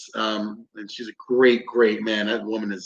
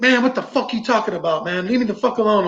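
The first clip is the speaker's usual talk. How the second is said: higher-pitched, agitated retort; retold aggressive outburst; 7 semitones higher than usual. Entranced teammate snapping back at the investigator, aggressive. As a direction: imitate a teammate lashing out irritably. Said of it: "he started getting aggressive".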